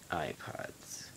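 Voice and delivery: hushed whispered aside; sponsor whisper. Pretend. Host whispering the sponsor's name to viewers.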